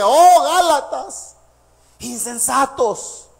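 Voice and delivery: very slow, loud Oh Galatas, then drawn-out insensatos; surprised rebuke. Acted. Preacher as astonished Paul calling out to the Galatians. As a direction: cry out in shocked dismay. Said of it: "se quedó sorprendido y le dice".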